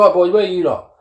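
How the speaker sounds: a hint of a bouncer sizing up the lads; gruff head honcho. Head bouncer challenging the lads at Oktoberfest, bossy and loud. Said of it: "He goes".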